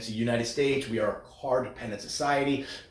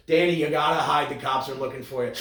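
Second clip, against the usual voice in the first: usual speaking voice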